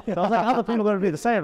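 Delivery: high, laughing through it; chuckling at himself. Real, not acted. Laughing about being a lazy customer. Laughing at his own takeaway habit.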